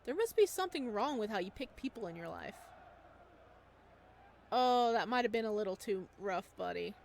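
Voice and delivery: ordinary talk, nothing marked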